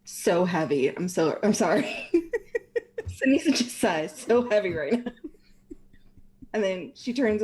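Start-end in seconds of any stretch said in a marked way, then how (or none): 1.4-5.1 giggling through the words; laughing while trying to speak. Real, not acted.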